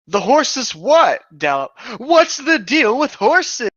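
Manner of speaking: a slow, high, put-upon retort; indignant cut-in. Acted. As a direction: drag it out, baffled and exasperated. Dalamar cuts in, irritated.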